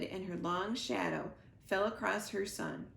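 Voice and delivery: usual speaking voice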